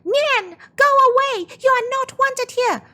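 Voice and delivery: high, loud shouting at the monster; May driving Nian off with a pot, defiant high yell. Acted. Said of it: "She yelled".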